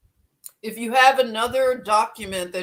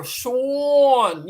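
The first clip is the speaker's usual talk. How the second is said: loud "Sean" drawn long with a broad aw; Philly accent; 4 semitones higher, 6 dB louder than usual. Shown as a demonstration. Showing viewers the Philly "Sean", louder and stretched. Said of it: "that's how we say it in Philly".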